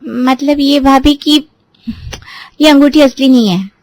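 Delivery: unwilling confession; hesitant. A narrator's reading. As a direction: drag the words out reluctantly. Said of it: "वो झिझकते हुए कहती है".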